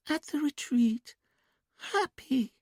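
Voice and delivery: put-on high, slow childlike sing-song; quiet sing-song. A narrator's reading. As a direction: drift the words like a dreamy child. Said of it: "Julia spoke in a quiet, sing-song voice".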